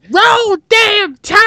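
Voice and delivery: high, loud, drawn-out slow yell; mock fan cheer. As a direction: whoop it like a mock football fan.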